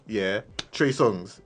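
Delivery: ordinary talk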